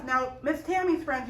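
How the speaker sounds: ordinary delivery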